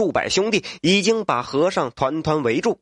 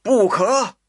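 a sharp, higher shout of alarm; 5 semitones higher, 3 dB louder than usual. Acted. Daughter cries out to stop her father freeing monks, shouting. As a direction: cry out to stop it, loud and high. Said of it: "就听有人大喊".

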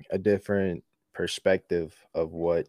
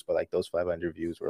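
ordinary talk

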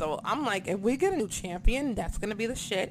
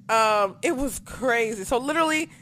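ordinary talk, nothing marked

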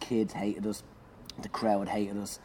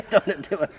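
laughing repeat; 9 semitones higher, 7 dB louder than usual. Retold or imitated.

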